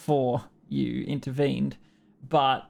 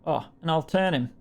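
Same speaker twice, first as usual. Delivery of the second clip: usual speaking voice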